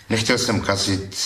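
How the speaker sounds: ordinary delivery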